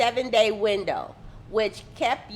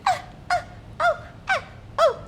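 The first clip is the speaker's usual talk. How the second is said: high, thin cries of pain; hammed-up pained moans; mock pained whimpers; faster than usual. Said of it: "and she was just".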